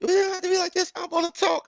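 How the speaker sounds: very high, whiny woman's voice; mock her begging at the bus stop; hammed-up high whiny woman; frantic girlfriend. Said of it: "She pulling up, just wilding".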